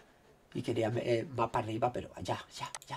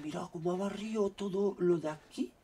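usual speaking voice

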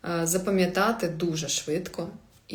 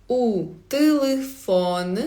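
"У телефон" stretched out syllable by syllable; slow Portuguese "У телефон"; slow model pronunciation; 2 semitones higher, slower than usual. Shown as a demonstration. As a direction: draw "У телефон" out for learners to copy. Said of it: "Давайте за мною".

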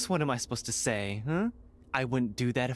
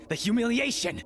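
dramatic outburst; 9 semitones higher than usual. Acted. Heir ranting about her family's exile, dramatic outburst. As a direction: let the old bitterness burst out.

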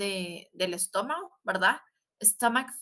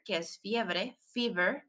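ordinary talk, nothing marked

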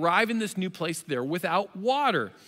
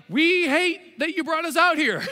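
higher, louder griping crowd; whiny crowd; 9 semitones higher, 5 dB louder than usual. Retold or imitated. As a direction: gripe loudly in a put-on voice. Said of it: "they're all like".

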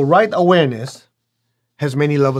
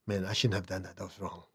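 quiet regret; 6 semitones lower, 18 dB quieter than usual. Retold or imitated. Remorseful self-talk next day.

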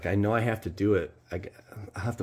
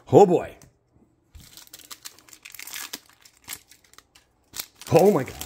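loud, high burst of hype; hyped exclamation; 10 semitones higher, 8 dB louder than usual. Real, not acted. About to reveal a big pull, loud and hyped. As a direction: blurt it out, bracing for the reveal.

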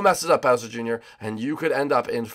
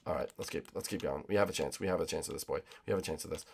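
low, soft pep talk; hushed self-encouragement; 7 semitones lower, 13 dB quieter than usual. Real, not acted. Gamer steadies himself after setback, hushed resolve. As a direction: murmur encouragement to yourself, low.

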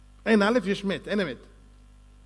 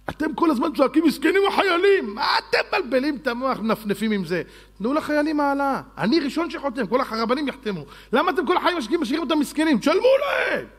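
much higher and louder, building to a shout; angry preaching shout; 8 semitones higher, 4 dB louder than usual. Real, not acted. Rabbi berates critics about soldiers' pay, shouting.

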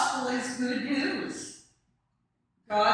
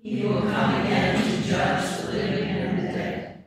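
louder, steady chanted recitation; communal creed recitation; 3 semitones lower, 4 dB louder than usual. Real, not acted. Whole congregation standing to recite the Apostles' Creed, chanted in unison. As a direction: recite the creed together in measured rhythm.

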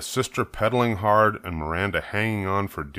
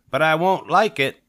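put-on high, loud, stubborn little girl; grudging child; 8 semitones higher, 6 dB louder than usual. Daughter tells mother she won't like it, stubborn. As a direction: dig your heels in.

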